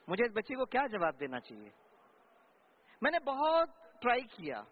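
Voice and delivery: ordinary talk, nothing marked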